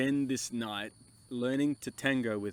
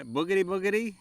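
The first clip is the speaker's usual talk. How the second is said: mock Spanish babble; silly nonsense syllables; fake Spanish gibberish; 5 semitones higher, 3 dB louder, faster than usual.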